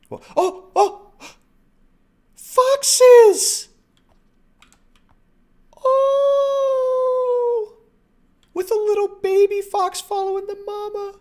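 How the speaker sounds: high, loud squeal of delight. Real, not acted. Delighted at seeing foxes.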